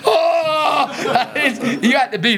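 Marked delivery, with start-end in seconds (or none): 0.1-0.9 high, drawn-out mock yell; hammed-up gasp of men getting soaked; imitated shocked cry. Comedian imitating two men drenched by the prank, mock shocked cry. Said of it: "they stood up and went".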